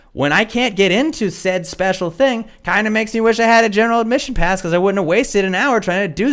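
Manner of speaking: high-pitched retold protest; exasperated appeal. Retold pleading argument with staff.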